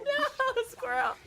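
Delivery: laughing yell. Real, not acted. Laughing at squirrel joke.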